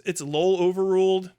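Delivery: ordinary talk, nothing marked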